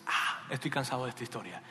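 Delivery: low, soft, fed-up sigh of words; weary. Acted. Soldier tired of excuses, weary and low. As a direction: say it wearily, dropping the voice. Absalom's killer, fed up, in retelling.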